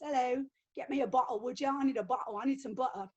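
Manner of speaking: Cockney servant accent. Shown as a demonstration. Historian showing how dramas voice servants, Cockney accent. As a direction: talk like a Cockney servant, swallowing the t's. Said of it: "Or they use Cockney for the servants".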